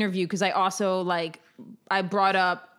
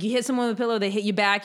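ordinary talk

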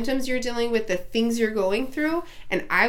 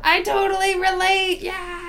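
squeaky high, half-crying voice; high-pitched voice; 8 semitones higher, slower than usual. Pretend. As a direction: go high and whimper it. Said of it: "talking in such high voices".

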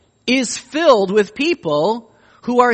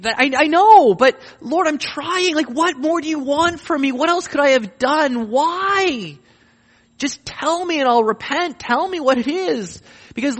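pleading prayer; 4 semitones higher than usual. Acted. Anguished believer begging God for an answer, pleading. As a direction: cry out to the Lord, near despair.

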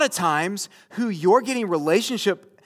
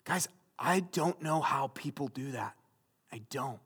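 hushed sincere aside; 6 semitones lower, 12 dB quieter than usual. Real, not acted.

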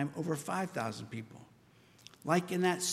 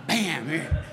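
louder mock thunderclap; playful bam; 6 dB louder than usual. Preacher imagining Jesus' lightning bolt at disciples: loud playful bam. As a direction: slam it down in fun, then hand it over.

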